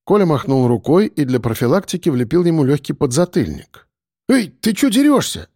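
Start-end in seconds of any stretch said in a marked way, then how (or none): 4.3-5.5 high outraged yelp; indignant protest. A narrator's reading. Boy slapped on the head, protesting high and outraged. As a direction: protest the slap, affronted. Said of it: "возмутился Егор".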